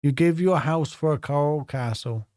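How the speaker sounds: measured recital with long pauses between phrases; deliberate, phrase-by-phrase poetry reading; poem reading. A narrator's reading. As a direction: read the poem line by line, letting each phrase land. Reads a poem aloud.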